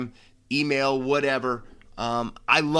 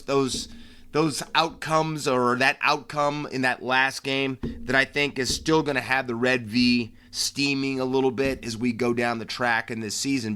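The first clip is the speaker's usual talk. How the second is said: normal talking voice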